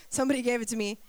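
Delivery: ordinary talk, nothing marked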